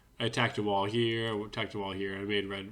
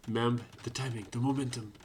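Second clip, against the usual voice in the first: put-on light, half-hearted Spanish accent; attempted Spanish accent. Gamer quoting Mem on timing and momentum, in an attempted Spanish accent. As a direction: have a rough go at a Spanish accent. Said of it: "I can't do a Spanish accent".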